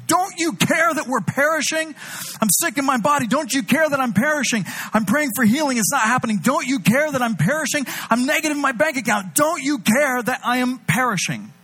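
high whining complaint to God; desperate plea. Acted. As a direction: wail it like someone sinking. Voicing desperate believers' complaint.

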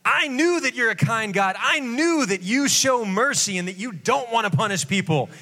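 high, petulant complaint; whiny Jonah. Acted. Jonah complaining to God about his mercy, high and whiny. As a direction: sulk at God for being kind.

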